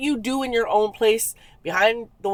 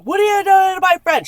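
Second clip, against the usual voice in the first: high, loud retold challenge; piped-up confrontation; 11 semitones higher, 7 dB louder than usual. Girlfriend confronting the man at the gym, indignant. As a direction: burst out high and loud, imitating a protective friend. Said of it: "one piped up".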